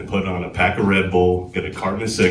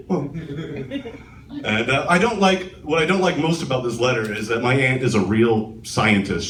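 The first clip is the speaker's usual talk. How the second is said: usual speaking voice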